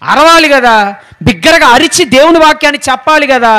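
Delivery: forceful preaching. Real, not acted. Preacher insisting God's word must be cried out, forceful preaching. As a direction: press each point on the congregation emphatically.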